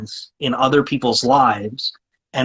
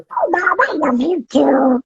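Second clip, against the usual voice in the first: high squawky duck voice, slow and loving; Donald Duck voice; 13 semitones higher, slower than usual. Retold or imitated. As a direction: answer mom lovingly in the duck voice. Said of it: "who taught me the voice".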